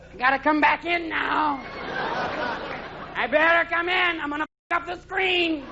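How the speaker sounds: high, slow, drawn-out yowl; high-pitched cat whine, stretched out long; demanding cat. Acted. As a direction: yowl the words like an insistent cat. Cat demanding to come back in.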